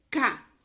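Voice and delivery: "ca" alone, loud and distinct; isolated syllable. Shown as a demonstration. Teacher showing pupils one syllable, "ca" said loudly alone. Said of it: "Uma sílaba".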